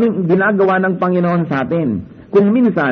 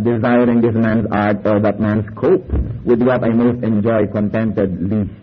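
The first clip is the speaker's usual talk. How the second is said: quoting Shakespeare; 6 semitones lower, slower than usual. Shown as a demonstration. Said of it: "Yung sabi ni Shakespeare na".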